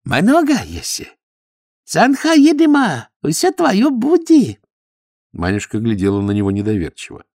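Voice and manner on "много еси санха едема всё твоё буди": high, slow, boastful broken Russian; proud Chinese suitor. A narrator's reading. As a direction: brag about riches.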